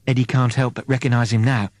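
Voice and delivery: usual speaking voice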